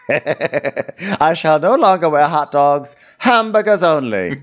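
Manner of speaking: grand mock queen voice, drawn out; mock royal proclamation. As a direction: pronounce it like a self-important queen. Mock regal show-character declaration.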